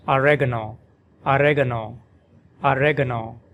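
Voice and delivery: oregano as "a-REG-a-no", stress on reg, short uh in the middle; American oregano. Shown as a demonstration. Teacher demonstrating oregano in American English, stress on reg. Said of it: "pronounced in American English as".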